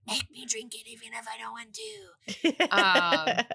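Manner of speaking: put-on breathy whisper of a wise old wizard; whispered Dumbledore. Will urging Tui to drink, whispered like Dumbledore. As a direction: hush it like Dumbledore, slow and grave. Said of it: "is very Dumbledore".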